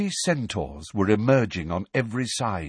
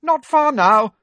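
high, loud shout; 9 dB louder than usual. Acted. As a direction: shout ahead to them. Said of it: "shouted Hermione".

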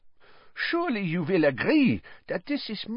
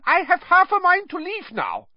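much higher, louder furious outburst; exploding in anger; 4 dB louder than usual. A narrator's reading. Karkaroff storming at the other heads, exploding. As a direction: blow up and threaten to leave. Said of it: "exploded Karkaroff".

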